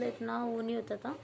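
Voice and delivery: ordinary talk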